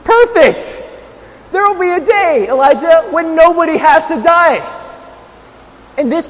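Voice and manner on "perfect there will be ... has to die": high, delighted proclamation; exultant reply. Retold or imitated. Father answering his son Elijah's wish, exultant. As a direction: burst out high, then ease slightly while still lifted. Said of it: "And I said".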